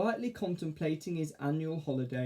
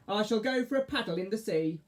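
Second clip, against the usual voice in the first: witch voice; 7 semitones higher than usual. Acted. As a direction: crow it like a witch.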